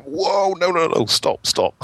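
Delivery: high-pitched protest, half laughing; pleading to halt, hammed-up and high; mock alarm. Marketer fending off software groups eyeing the budget, mock alarm.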